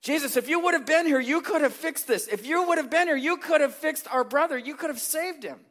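loud, high, frantic pleading; out of breath and frantic, blaming Jesus; indignant. Acted. Sisters rushing up to Jesus, frantic and indignant. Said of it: "indignant with Jesus".

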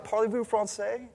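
French. Retold or imitated. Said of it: "you start speaking to you in French".

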